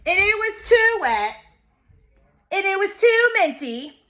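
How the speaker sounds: high, protesting mock yell; childish complaining. A narrator's reading. Child complaining at bath and bedtime, high mock yelling. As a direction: keep up the cross toddler protest.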